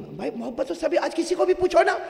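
impassioned preacher's climb; fiery sermon. Acted. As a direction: drive it home to the crowd. Preacher rousing the audience.

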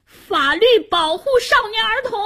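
put-on very high, protesting child's shout; struggling boy's cry. A narrator's reading. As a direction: cry out the law while being seized. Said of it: "夏楠挣扎着喊道".

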